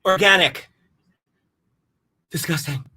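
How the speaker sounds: ordinary delivery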